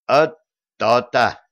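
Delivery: emphatic, loud, unruffled verdict; imperturbable. A narrator's reading. Unruffled voice in the silence seals the argument, imperturbable. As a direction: stamp the point down, unruffled. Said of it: "чей-то невозмутимый голос".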